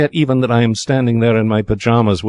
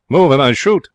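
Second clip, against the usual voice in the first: higher, louder squawked threat; parrot's phrase; 6 semitones higher, 4 dB louder than usual. Acted. Parrot threatens the crowd in the corridor, squawked. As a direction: parrot it out like a bird.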